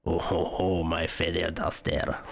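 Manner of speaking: low, slow fake French accent, savouring my Feather Duster. Viewer playing Lumiere in a French accent. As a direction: purr it in a mock French accent. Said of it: "If Ewan McGregor can do that accent".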